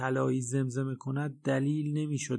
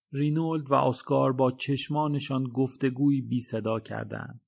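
ordinary delivery, nothing marked

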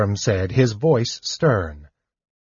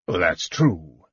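husky; 5 semitones lower than usual. A narrator's reading. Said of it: "he said huskily".

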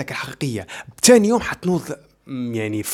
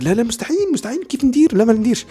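mock horrified refusal; 8 semitones higher than usual. Imagined listener refusing to express feelings.

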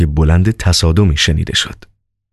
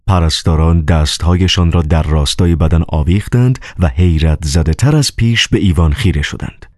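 ordinary delivery, nothing marked

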